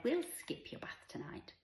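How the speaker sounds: high, slow, indulgent; playful mum. A narrator's reading. Mother lets her daughter skip the bath, playful.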